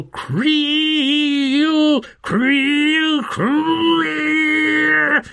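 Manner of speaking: high, drawn-out, slow screeching cry; dragon's shriek. A narrator's reading. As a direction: stretch out the creel like a monster's howl. Dragon's cry voiced by narrator.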